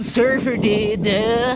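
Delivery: high, loud, slow surfer drawl; surfer dude voice. Shown as a demonstration. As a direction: play a dopey surfer dude. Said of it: "using affect into my voice to make me sound like some surfer dude".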